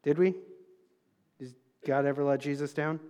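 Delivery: asked softly and low to the crowd; a quiet, low question to the congregation. Shown as a demonstration. Preacher asks the congregation softly, low and quiet. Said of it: "I'm preaching my heart out up here".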